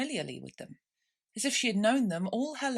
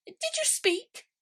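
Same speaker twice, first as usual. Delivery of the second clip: very high, slow stiffly polite question; a frown held behind good manners; polite frowning mouse; slower than usual. A narrator's reading. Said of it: "frowning, but very politely".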